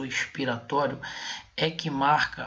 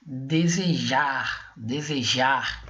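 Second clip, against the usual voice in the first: "desejar" very slowly, final JAR drawn out; slow teaching demo of "desejar", leaning on JAR; stress on JAR; much slower than usual. Shown as a demonstration. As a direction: stretch and weight the last syllable JAR in "desejar". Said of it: "nós temos aqui o jar".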